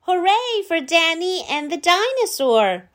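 high, bright mock cheer. The crowd cheering for Danny, high and excited. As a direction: cheer for the winners. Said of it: "everyone cheered".

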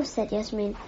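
plain reading voice

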